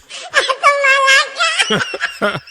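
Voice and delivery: high, loud laughing fit; loud cackling laugh. Real, not acted. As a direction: burst out laughing uncontrollably. Laughing hard at clip.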